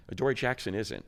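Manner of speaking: ordinary talk, nothing marked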